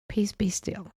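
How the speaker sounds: calm. Shown as a demonstration. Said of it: "deep breath and go".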